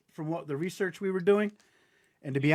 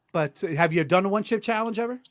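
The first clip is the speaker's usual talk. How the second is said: ordinary talk, nothing marked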